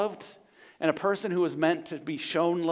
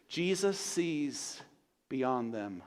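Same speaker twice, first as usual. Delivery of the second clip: hushed; 4 semitones lower, 9 dB quieter, much slower than usual. Real, not acted. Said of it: "sermon climax, hushed".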